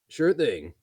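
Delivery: loud holler over shoulder. Acted. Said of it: "hollered over his shoulder".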